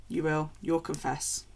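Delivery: ordinary talk, nothing marked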